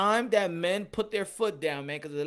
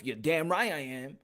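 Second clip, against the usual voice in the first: normal talking voice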